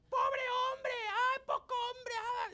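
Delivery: very high mock shrieking; insulting screech. Offended ladies insulting the guy in line, screeching. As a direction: squeal insults in a stretched screech. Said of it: "They started insulting the guy".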